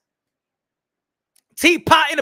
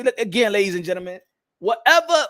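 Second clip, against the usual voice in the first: usual speaking voice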